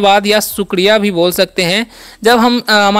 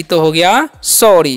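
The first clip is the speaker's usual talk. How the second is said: slowly lands on sorry with a long final ई; slow pronunciation demo; 4 semitones lower, slower than usual. Shown as a demonstration. Teacher revealing sorry to students, slowly. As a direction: stretch out sorry after spelling it. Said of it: "double R का र और Y का ई".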